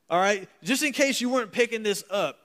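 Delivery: put-on country boy voice. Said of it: "country boy translation".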